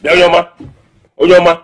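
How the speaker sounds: loud, high shout of the name; warning call. Acted. Man interrupting a meddler who talks to his woman, warning shout. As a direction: call out sharply to cut them off.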